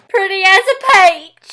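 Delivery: high, loud Southern twang; Southern accent. Shown as a demonstration. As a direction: drawl "peach" slowly like "paych". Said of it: "Pretty as a peach becomes".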